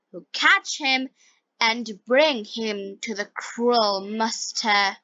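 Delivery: usual speaking voice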